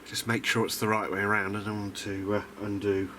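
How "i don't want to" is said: ordinary talk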